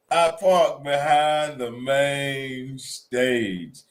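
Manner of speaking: very slow, singsong boast; playful gloating. Real, not acted. Bragging to co-host about his parking spot, gloating singsong. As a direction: draw out every word, savoring the win.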